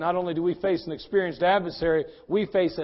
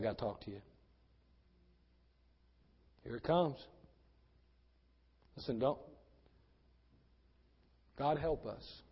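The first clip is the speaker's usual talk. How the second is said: much softer and lower intimate sermon; preacher turns quiet and grave; hushed preaching; 6 semitones lower, 9 dB quieter than usual. Acted. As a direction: drop it down low and quiet.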